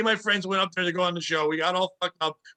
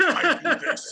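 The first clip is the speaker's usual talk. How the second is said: mock Batman line; 7 semitones higher, much slower than usual.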